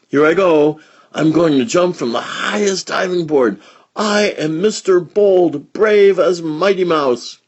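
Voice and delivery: put-on high, loud bragging kid voice; boastful show-off. Boy on the diving board shows off, loud boasting. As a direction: puff up and boast from the top. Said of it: "a diving board story".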